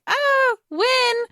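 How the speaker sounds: put-on very high, little-girl plea; Prim's voice. Little sister begging Katniss to win, very high. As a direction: squeak it out like a kid sister. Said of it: "her sister's like".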